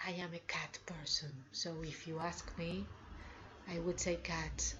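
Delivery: hushed confiding whisper; whispered aside. Real, not acted. Teacher confiding to students she loves cats, whispered. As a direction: confide it softly like a secret.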